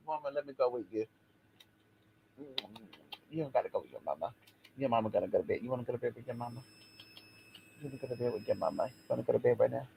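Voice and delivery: put-on fast, soft baby-talk voice; baby talk to the dog. To Bella the dog, quick soft baby talk. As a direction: coo at the dog like a toddler. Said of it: "She's like".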